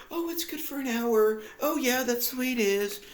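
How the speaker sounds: high, chirpy mock old-lady voice; cheerful old ladies. Mocking the cheerful old women on the bus, high chirpy voice. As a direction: imitate the old women, sing-song and cheery. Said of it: "they're just cheerfully like".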